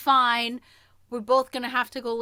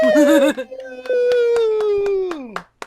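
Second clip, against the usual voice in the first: high, loud burst of laughter; 13 semitones higher, 14 dB louder than usual. Real, not acted. Laughing at a cheer, laughing loud.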